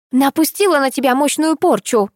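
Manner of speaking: raving madman; shrieked curse; screaming. A narrator's reading. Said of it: "заорала сумасшедшая баба". (yell it like a raving witch)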